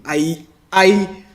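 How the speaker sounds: real stammer on the opening word; halting start, word repeated. Real, not acted. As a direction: get stuck repeating the first word. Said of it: "I may stammer from time to time".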